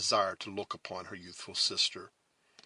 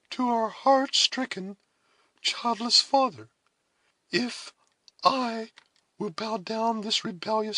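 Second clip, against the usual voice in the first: high, earnest voice in measured phrases; tender elder sister; 13 semitones higher, 5 dB louder than usual. A narrator's reading. Cora telling Alice of the offer, tender earnestness. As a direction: explain haltingly, with pauses and rising feeling. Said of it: "in an expression of tenderness".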